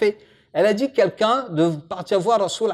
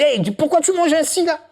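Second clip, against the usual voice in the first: mock scolding; 8 semitones higher than usual. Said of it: "Non, jamais, il crie pas".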